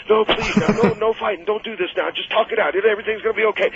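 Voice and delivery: high anxious begging; scared friend begging everyone to calm down; begging Tommy. Retold or imitated. Tommy begging, fight about to start.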